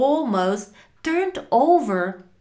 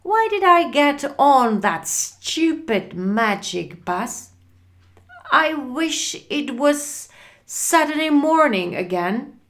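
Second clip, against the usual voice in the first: higher, louder cry of regret; 7 semitones higher, 5 dB louder than usual. A narrator's reading. Frank lost at night regrets the magic bus, crying out. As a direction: bemoan it in exasperated despair. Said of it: "he cried".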